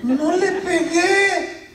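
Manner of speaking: put-on high, slow childish protest with a laugh; child denying. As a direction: plead innocence like a little boy. Son denies hitting sister. (niño negando, voz aguda y lenta)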